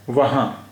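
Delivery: वहाँ ending in a nasal aa, n not sounded; nasalized vowel ending. Shown as a demonstration. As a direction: leave the end of वहाँ open and nasalized. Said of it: "नून गुन्ना है इसलिए".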